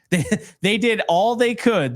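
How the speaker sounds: chuckling through the words; laughing. Real, not acted. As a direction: laugh while you say it. Joking about drunk Yankees.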